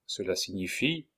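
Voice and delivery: ordinary delivery, nothing marked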